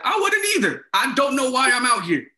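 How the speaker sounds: high, loud mock outburst; exasperated protest. RA to supervisor about being called out, exasperated protest. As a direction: yelp it in hammed-up exasperation.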